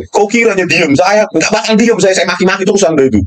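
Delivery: high-pitched defiant rant; defiant outburst. Real, not acted. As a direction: swear you won't stay silent, voice up high. Defiant tirade at the police chief. (menantang dengan nada tinggi)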